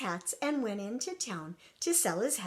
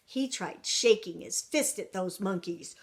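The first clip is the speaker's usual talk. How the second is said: ordinary delivery, nothing marked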